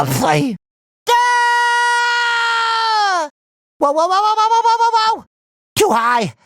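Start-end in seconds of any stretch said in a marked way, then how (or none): 1.1-3.3 sustained high-pitched cartoon scream; long high scream. Hero in sudden trouble, long high scream. Alarm mid-adventure. 3.8-5.2 wobbling whoas climbing high; rapid whoas rising into panic; rising alarm. Acted. Hero losing control, whoas climbing high in alarm.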